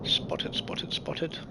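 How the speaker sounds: whispered alarm; urgent whisper. Real, not acted. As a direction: hiss it under your breath. Warning he has been spotted.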